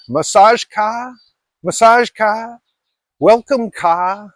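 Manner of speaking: high, slow, sing-song calls with "ka" rising at the end; mock massage-parlor call, high and drawn out; massage girls calling. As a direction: lure passersby like a street massage girl.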